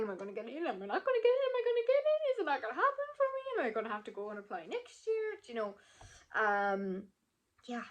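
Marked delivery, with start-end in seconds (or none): 0.0-5.3 high, rapid-building fretting; mock worrying. Applicant imitating her own worries about admission, frantic. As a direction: spiral into anxious questions, pitch leaping up. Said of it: "no more worrying".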